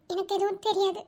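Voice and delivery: ordinary delivery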